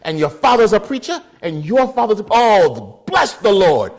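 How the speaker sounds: put-on loud, high, delighted Jamaican accent; overjoyed Jamaican elder, praising loudly; old-school Jamaican man. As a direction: exclaim with booming Jamaican approval. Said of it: "old, old school Jamaican man".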